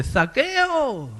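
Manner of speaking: high call of the name. Acted. Jesus calling Zacchaeus by name, high call. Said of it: "le llama por su nombre".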